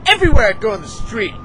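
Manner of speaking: ordinary delivery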